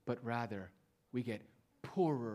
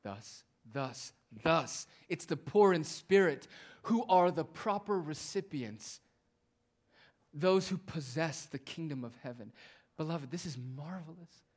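normal talking voice

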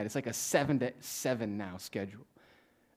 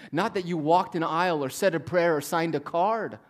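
raised, higher preaching voice; forceful preaching; 6 semitones higher, 8 dB louder than usual. Real, not acted. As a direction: drive it home to the congregation, loud and high.

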